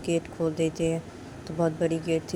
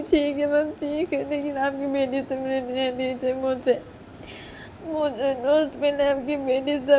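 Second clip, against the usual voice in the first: tearful pleading; 9 semitones higher, 4 dB louder than usual. Acted. Girl at the gate tearfully begging the mistress. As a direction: beg through tears. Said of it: "PU को बोलती".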